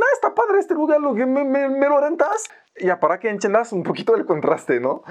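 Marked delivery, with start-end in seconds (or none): 0.0-2.4 high, mock-naive voice playing a clueless kid; naive clueless guy. Two young guys asking to rent the Paris Opera, mock naive high voice. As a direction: ask sweetly like you don't know how out of place you are. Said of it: "imagínate dos chavos que llegan en el Ópera y que dicen".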